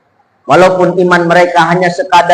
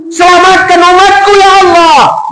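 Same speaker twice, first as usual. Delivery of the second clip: pleading prayer; 9 semitones higher than usual. Acted. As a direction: beg God to save his people. Preacher voices the Prophet's plea. (doa memohon dengan suara tinggi dan keras)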